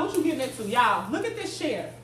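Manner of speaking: ordinary talk, nothing marked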